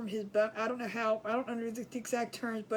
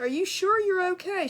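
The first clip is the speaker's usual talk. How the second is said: raised, higher, pressing the friend; worried insistence; 8 semitones higher, 6 dB louder than usual. Retold or imitated. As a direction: push her hard to admit it.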